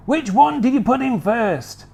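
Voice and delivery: high, keen question; eager Mister Cranky. Acted. Mister Cranky quizzing George, high and eager. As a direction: ask it bright and pushy.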